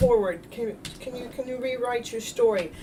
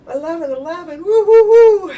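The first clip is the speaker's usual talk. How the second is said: high, loud hooting woo-woo; mock spooky woo; 8 semitones higher, 14 dB louder than usual. Healer mocking her own spiritual alarm, high spooky hooting. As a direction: ham up a mystical ghostly woo.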